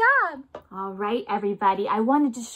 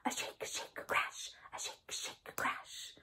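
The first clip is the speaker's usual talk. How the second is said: soft whispered shake shake crash; whispered tambourine sounds; 20 dB quieter than usual. Shown as a demonstration. Teacher to kids, imitating a tambourine in a whisper. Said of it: "Can you do that with me".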